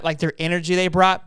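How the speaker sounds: ordinary talk, nothing marked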